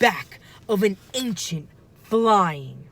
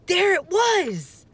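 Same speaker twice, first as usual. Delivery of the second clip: relieved excitement; 11 semitones higher, slower than usual. A narrator's reading. Jack finds the book.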